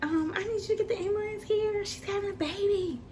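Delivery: high, urgent mom voice; imitating mom calling the ambulance; mom on the phone. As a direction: mom telling the ambulance to hurry. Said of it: "She's like".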